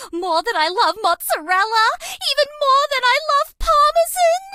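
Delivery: usual speaking voice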